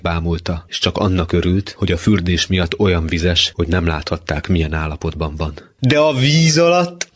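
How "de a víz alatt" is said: high, drawn-out teasing; mocking. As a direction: build up the joke in a high voice. Ábel continues mocking his brother. (gúnyosan, magasan, elnyújtva)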